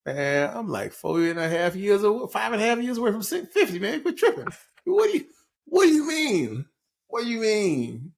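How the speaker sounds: high-pitched, hammed-up protest; mock indignant protest. Comic protesting that fifty isn't the end, mock indignant. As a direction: protest in a climbing, incredulous high voice.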